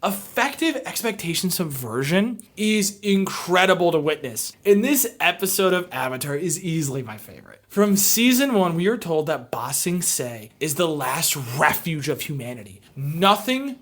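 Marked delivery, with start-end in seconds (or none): none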